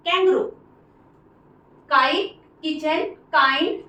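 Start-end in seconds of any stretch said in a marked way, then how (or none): 0.1-0.4 "कैंगरू" as two syllables, KANG-groo, pitched high; corrected pronunciation. Shown as a demonstration. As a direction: model "कैंगरू" high, with no long middle vowel. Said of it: "इसको कैंगरू बोलते हैं".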